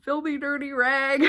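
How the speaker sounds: high, whiny self-pitying voice; pitiful mock wail; drama queen. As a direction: ham up the self-pity. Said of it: "being a little drama queen".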